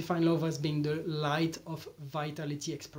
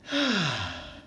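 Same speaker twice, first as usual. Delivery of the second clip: long falling moaning sigh; big sigh of release; sigh of pleasure. Shown as a demonstration. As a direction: exhale audibly, sliding down in pitch. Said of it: "let's do it again".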